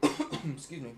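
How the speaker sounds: coughing, choking on water; sputtering after a swig of water; choking on a drink. Real, not acted. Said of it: "choking on my water".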